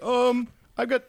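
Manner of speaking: high, hesitant comic voice; mock-timid voice among wizards; hapless player. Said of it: "He's like".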